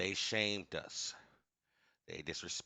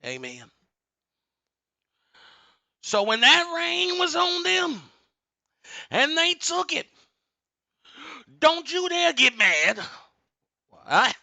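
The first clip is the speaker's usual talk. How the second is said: booming motivational speech; 7 semitones higher, 9 dB louder than usual. Real, not acted. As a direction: thunder it like a revival preacher. Sermon-like warning, asks for amen.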